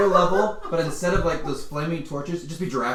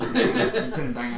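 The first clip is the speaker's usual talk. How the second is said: slow, high, laughing Lieutenant Dan; mock Lieutenant Dan accent; 7 semitones higher, slower than usual. GM mocks player's accent, laughing slowly. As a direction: drawl it out like Lieutenant Dan, cracking up. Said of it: "Where did your accent go?".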